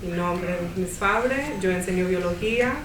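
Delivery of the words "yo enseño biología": normal talking voice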